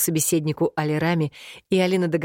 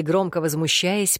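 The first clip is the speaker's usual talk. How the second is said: usual speaking voice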